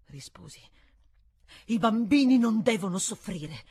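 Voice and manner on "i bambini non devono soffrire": louder, each word weighted; emphatic retort. A narrator's reading. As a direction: insist firmly. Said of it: "ribatté con enfasi".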